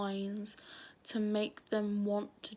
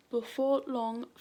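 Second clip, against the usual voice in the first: ordinary talk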